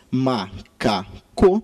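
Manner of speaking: MACACO split slowly into three separate syllables. Shown as a demonstration. Teacher showing a three-syllable word, macaco said syllable by syllable. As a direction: sound out "macaco" in slow, separate beats. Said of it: "Uma palavra com três sílabas".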